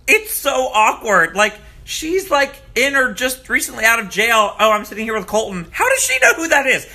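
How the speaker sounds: high, loud, disbelieving rant; shocked and amused, pitch up high; incredulous. Real, not acted. Outraged disbelief at jail call.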